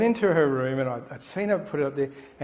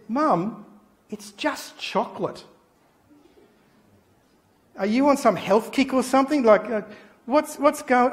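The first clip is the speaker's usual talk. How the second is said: put-on bewildered child; 7 semitones higher than usual. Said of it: "I said".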